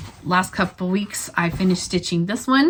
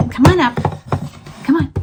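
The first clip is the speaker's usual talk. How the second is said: higher, louder coaxing call; calling a dog; 8 semitones higher, 6 dB louder, faster than usual. Real, not acted. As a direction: coax the dog up.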